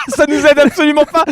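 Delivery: high, laughing complaint. Real, not acted. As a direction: laugh through the words. Laughing at useless clue.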